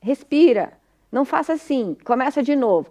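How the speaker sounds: high, firm parental interruption; acting out parents cutting a child short; pais corrigindo. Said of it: "os pais usam artifícios de". (pais interrompendo a criança, em tom de ordem)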